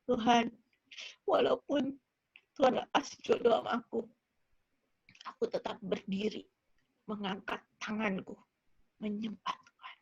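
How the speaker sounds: low, soft, broken-up prayer; subdued imitation of weeping surrender; tearful prayer, re-enacted. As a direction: pray it quietly as if through tears. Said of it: "Sambil nangis tapi".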